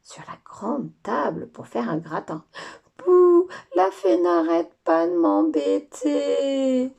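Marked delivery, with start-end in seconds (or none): 3.0-6.9 loud, slow whining sob; crying child. Acted. Lola crying into her father's apron about the fairy. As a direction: wail it like a hurt little girl.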